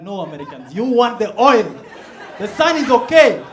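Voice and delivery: punchline shouted at Americans over oil; mock retort. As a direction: call them out with a big laughing reply. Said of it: "I'm like".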